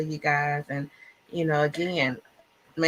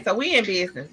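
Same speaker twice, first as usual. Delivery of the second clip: ordinary talk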